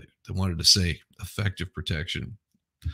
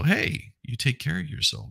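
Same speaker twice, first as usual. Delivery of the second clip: usual speaking voice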